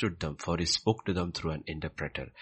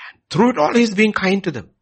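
higher and much louder preaching voice; full-force preaching; 13 semitones higher, 11 dB louder than usual. Acted. Preacher to congregation, fervent loud preaching. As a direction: drive it home from the pulpit.

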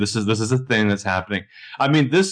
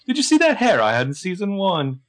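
put-on British accent, pitched up; hammed-up British accent, stretched out; Toby; 6 semitones higher than usual. Joking that Toby was secretly British, mock British accent. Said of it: "what if Toby starts speaking British".

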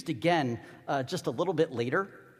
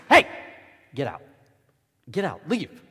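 short, separated stern orders; acted shooing, one curt order at a time; scolding dismissal; 2 semitones lower, 6 dB louder than usual. Disciples send women and children away, scolding. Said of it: "that's the feel that every disciple has".

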